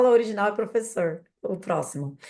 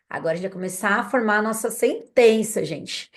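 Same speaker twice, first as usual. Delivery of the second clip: ordinary talk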